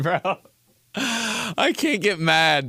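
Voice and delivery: ordinary talk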